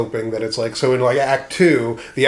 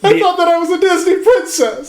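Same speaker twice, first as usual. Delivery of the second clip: very high, louder princess voice; Disney princess; 4 dB louder than usual. Retold or imitated. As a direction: play a dreamy fairy-tale princess. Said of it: "mocking the bard".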